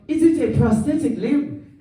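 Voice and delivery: high, curious mock voice; voicing Hector. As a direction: play Hector asking, a bit dumb. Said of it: "he pulls out and he's like".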